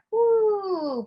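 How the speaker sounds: high loud woo out; exhale woo. Shown as a demonstration. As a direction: push the breath out. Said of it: "push it out".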